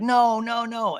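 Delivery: high-pitched imitated protest; hammed-up dismay; mock pleading. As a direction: act out friends begging someone to stay. Voicing group begging her to stay.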